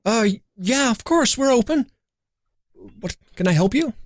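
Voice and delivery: a startled little shopkeeper answering; cheerful halfling shopkeeper, lightly done. Acted. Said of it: "This halfling just stops in her tracks".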